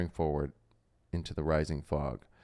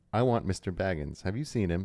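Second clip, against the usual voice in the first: ordinary delivery, nothing marked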